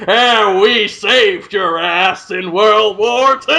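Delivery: slow, hammed-up boastful American voice; mock smug American. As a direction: drawl it like a smug know-it-all. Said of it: "whenever an American says".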